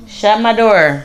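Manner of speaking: loud call across the house. Real, not acted. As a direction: shout it to the next room. Calling to son off camera.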